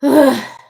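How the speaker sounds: loud, heavy huff; exasperated sigh. Real, not acted. Streamer fed up with immigration raids, loud exasperated sigh. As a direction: let out a loud exasperated groan.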